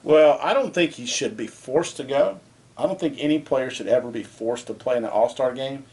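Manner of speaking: normal talking voice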